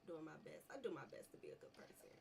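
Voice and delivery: soft breathy giggling words; stifled laughter. Real, not acted. Gamer stifles giggles insisting he's good. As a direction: hold back a laugh. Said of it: "trying not to laugh".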